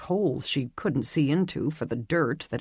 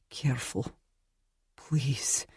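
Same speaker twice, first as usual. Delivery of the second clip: soft, hushed plea in pain; a quiet pleading whisper; 5 dB quieter than usual. A narrator's reading. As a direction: beg gently through the pain. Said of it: "A deep voice, full of pain".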